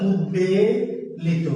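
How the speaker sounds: slow, careful উদ্বেলিত with doubled দ and silent ব; drawn-out demo word. Shown as a demonstration. Teacher drawing out উদ্বেলিত slowly for the class. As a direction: spell out উদ্বেলিত slowly, sound by sound. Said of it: "দয়ের সাথে কখনো কখনো হয়".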